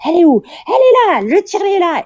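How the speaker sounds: high, loud triumphant shout; loud high-pitched cry of discovery; shouting nurses. Retold or imitated. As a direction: call out like you've caught a thief. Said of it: "j'entends genre".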